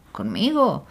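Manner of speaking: plain reading voice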